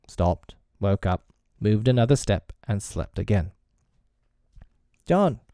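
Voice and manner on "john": put-on high small boy calling his brother; crying out. A narrator's reading. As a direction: call out for your brother, pitched high. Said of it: "Michael would cry".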